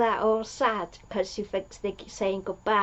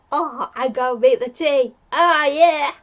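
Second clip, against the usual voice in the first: louder, higher fussing dad voice; sweet dad; 5 semitones higher, 8 dB louder than usual. Retold or imitated. Yaz's dad rushing to make tea for guests, loud and high. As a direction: bustle like a flustered happy father.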